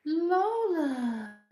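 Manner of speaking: high, loud drawn-out coo; cooing at a dog. Real, not acted. Owner gushing over her dog's face, cooing. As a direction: gush the pet's name fondly.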